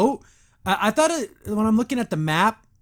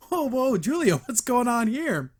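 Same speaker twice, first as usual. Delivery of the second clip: high, drawn-out mock astonishment; mock surprise; 6 semitones higher than usual. Viewer reacting to Julia's flirting, mock surprise. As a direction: react with a high, slow "whoa".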